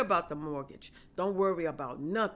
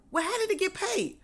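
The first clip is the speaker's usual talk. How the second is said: baffled question; 11 semitones higher than usual. Retold or imitated. Caller baffled by paid mortgage.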